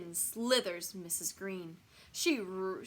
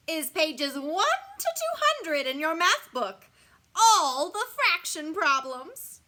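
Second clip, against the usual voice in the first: loud, high, gleeful monster teacher; grinning; 8 semitones higher, 12 dB louder than usual. A narrator's reading. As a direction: pile on the homework with loud relish. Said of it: "grins Missus Green".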